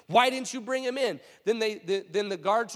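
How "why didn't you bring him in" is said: higher-pitched indignant demand. Acted. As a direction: demand an explanation as an angry superior. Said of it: "the leading priests and Pharisees demanded".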